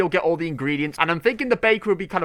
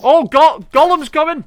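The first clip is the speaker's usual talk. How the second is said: high, louder alarmed call; excited alarm; 11 semitones higher, 8 dB louder than usual. Real, not acted. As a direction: warn everyone he is coming. Alarmed at approaching mob.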